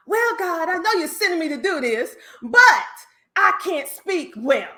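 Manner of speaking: voicing Moses. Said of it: "Similar to Moses".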